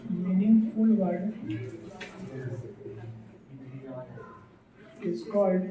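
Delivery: very slow, word by word, pausing for students to write; slow dictation. Acted. Teacher dictating an English definition to the class, slow dictation. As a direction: dictate each word so the class can copy it.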